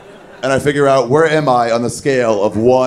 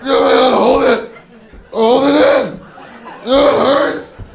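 much higher strained hammed-up cheering; a whole city groaning in sympathy; crowd cheering; 9 semitones higher, slower than usual. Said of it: "Sydney, Australia, just go like".

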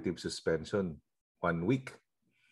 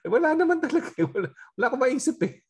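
words squeezed out through laughter, much higher and louder; giggling, high and a bit fast; laughing; 6 dB louder, faster than usual. Real, not acted. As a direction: laugh while getting the words out. Laughing through the dean story.

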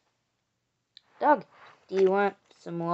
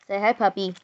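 ordinary talk, nothing marked

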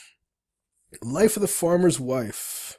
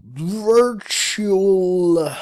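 VIRTUAL stretched long, higher and louder; drawn-out word; 4 semitones higher, 7 dB louder than usual. Pretend. Player typing shop name, VIRTUAL stretched out long. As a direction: draw out VIRTUAL in a big sustained swell.

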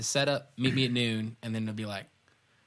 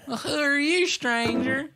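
squeaky laughing voice; 8 dB louder, slower than usual. Acted. Outlaw laughing, high squeak.

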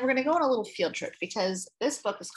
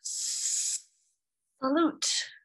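lisping whisper; 4 semitones higher than usual. Acted. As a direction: breathe it out with a lisp. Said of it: "lisped the slender canoe".

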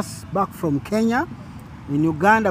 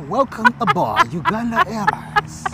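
much higher, slow mock announcement; airline announcer voice; 14 semitones higher, 3 dB louder, slower than usual. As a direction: announce the flight like cabin crew.

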